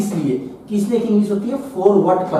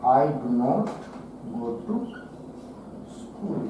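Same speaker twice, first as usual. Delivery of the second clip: very soft and low, halting between phrases; word-by-word dictation; 7 semitones lower, 11 dB quieter, much slower than usual. Shown as a demonstration. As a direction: say it to yourself while writing it out. Writing the English answer. (लिखते हुए धीमे, रुक-रुककर)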